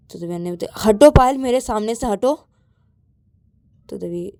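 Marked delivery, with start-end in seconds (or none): 0.8-2.3 loud, sharp order to move; irritated command, raised voice; angry. Acted. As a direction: demand they get out of the way. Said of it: "Ananya गुस्से में आके".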